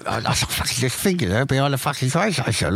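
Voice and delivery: low, muttered boasting; put-on low, quiet brag; boyhood liar. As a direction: imitate a grotesque little fibber, voice down. Acts out boy liar's boast.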